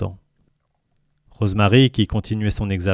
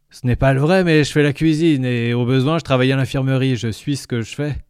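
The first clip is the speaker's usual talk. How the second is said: higher, louder creature voice; gurgling tentacle alien; 5 semitones higher, 3 dB louder than usual. Acted. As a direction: speak as the jovial alien. Lintel voice.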